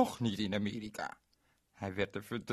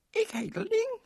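put-on much higher, louder little girl voice; 6 dB louder than usual. Ling introduces herself to Berend, high girlish voice. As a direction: play a bright small girl. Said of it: "zei het meisje".

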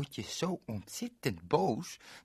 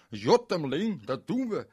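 louder, glad call; calling happily; 6 semitones higher, 8 dB louder than usual. Acted. Berend accepts Ling's plan, happy call. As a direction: shout for joy. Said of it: "riep hij blij".

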